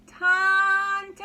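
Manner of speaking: high, loud, drawn-out call; long calling cry. Acted. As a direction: call out as if searching through the dark. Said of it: "she called again".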